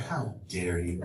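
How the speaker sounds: mock indignant. Shown as a demonstration. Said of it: "but you're saying".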